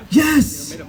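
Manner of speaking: ordinary delivery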